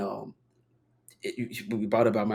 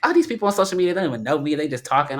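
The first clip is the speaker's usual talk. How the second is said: high, loud mock exasperation; pitched-up, mildly fed-up aside; dismissive; 6 semitones higher, 6 dB louder than usual. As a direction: brush off the haters, loudly. Imagined reply to online critics.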